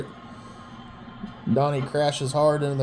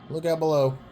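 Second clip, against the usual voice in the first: ordinary delivery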